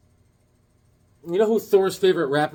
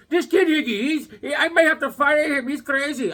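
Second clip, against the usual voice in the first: exasperated; 10 semitones higher than usual. Retold or imitated. Acts out Bobby's exasperated complaint.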